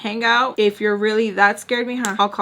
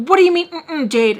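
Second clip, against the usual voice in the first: louder, higher exasperated question; exasperated demand; 8 semitones higher, 7 dB louder than usual. Acted. Mom fed up with grunted answers, exasperated demand. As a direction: raise voice and pitch in frustration.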